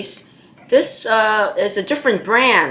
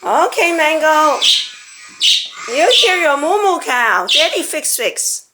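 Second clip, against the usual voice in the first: high, sing-song voice for the parrot; pet talk; 6 semitones higher than usual. Real, not acted.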